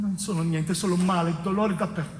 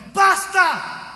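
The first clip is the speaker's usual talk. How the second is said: loud, high shout; much louder and higher, explosive; shouted basta; 11 semitones higher, 6 dB louder than usual. Acted. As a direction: cut everything off with a yell. Outburst ending the torment.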